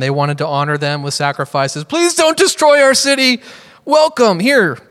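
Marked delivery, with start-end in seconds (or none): 1.8-4.8 high, hammed-up appeasing voice; exaggerated anxious welcome; mock frantic pleading. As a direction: beg the gods as nervous townsfolk. Imagined townsfolk appeasing feared gods.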